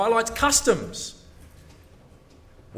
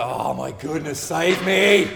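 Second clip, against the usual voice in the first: mock exasperation; 7 dB louder, slower than usual. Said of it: "comic exasperation aside".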